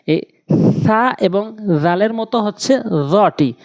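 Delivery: ordinary delivery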